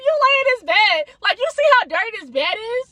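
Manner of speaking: high, indignant disbelief; outraged retort. Retold or imitated. Storyteller recalling her complaint about the dirty bed, high indignant retort. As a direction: replay the outrage in a high-pitched voice.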